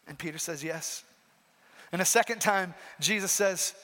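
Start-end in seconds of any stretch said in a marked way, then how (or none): 0.1-1.0 ashamed murmur. Acted. Peter ashamed answering Jesus.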